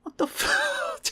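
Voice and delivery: laughing disbelief. Retold or imitated. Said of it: "And I'm like".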